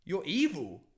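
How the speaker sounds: high-pitched, indignant retold telling-off; snapped out of it. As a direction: act out confronting someone, pitch well up. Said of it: "I just snapped out of it".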